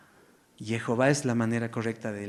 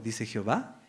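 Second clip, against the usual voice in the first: ordinary talk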